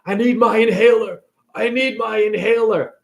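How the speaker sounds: imitating a man short of breath; acted breathless plea; mock asthma attack. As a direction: plead for air like a stricken old golfer.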